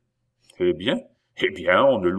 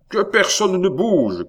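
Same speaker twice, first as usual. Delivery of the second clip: loud, high cry of command; shouted order; 12 semitones higher, 6 dB louder than usual. A narrator's reading. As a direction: shout to the stable hands to freeze. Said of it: "cria le maître de poste". (ordre crié, fort et aigu)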